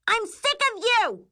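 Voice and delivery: very high, furious shriek; screaming. A narrator's reading. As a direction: scream it at your sister. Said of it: "Miri screams".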